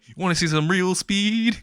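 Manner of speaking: high-pitched playful challenge; acted boast. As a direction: pitch it up like a mock dare. Acted boast before sprinting.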